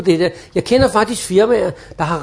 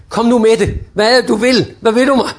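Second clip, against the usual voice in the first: impatient; 3 semitones higher, 3 dB louder than usual. Retold or imitated. Impatient listener thinking.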